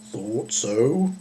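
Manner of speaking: lightly voiced knowing cow; a hint of a wise old cow. A narrator's reading. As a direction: cow answering the lost girl, lightly voiced. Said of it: "she said".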